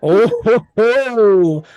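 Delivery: loud, high drawn-out whoop; delighted exclamation. Real, not acted. Game master reacting to a player's spell, delighted exclamation. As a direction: crow with loud, rising delight.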